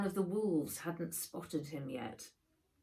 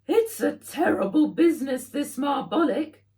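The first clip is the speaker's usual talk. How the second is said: Fred's voice; 9 semitones higher, 10 dB louder than usual. A narrator's reading. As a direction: draw it out in a high fussy character voice. Fred voiced again.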